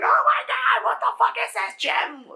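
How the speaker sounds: very high, loud mock scream of terror; panicked scream. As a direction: shriek it like monsters are coming. Monsters attack, people panic.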